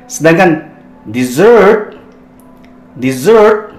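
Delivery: dessert said di-ZERT, stress on the second syllable; model pronunciation. Shown as a demonstration. Teacher contrasts dessert with desert, stress on ZERT. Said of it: "Ini yang ditekan zert ya".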